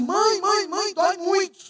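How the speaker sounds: high mock child crying out for mother; retold child's cry. Pastor retelling how the girl cried for her mother, imitated high wail. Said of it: "ela começou a gritar assim".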